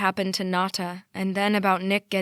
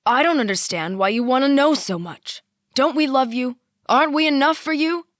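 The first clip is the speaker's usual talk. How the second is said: higher and louder, wounded; hurt, angry shout; 6 semitones higher, 7 dB louder than usual. A narrator's reading. Father shouting back at his questioning daughter. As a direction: throw it back at her.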